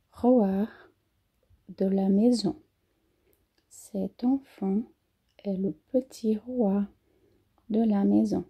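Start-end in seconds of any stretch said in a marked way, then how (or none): 3.7-4.8 "C'est un" linked, slow and whispered; slow whispered liaison. Shown as a demonstration. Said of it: "A gente faz a liaison".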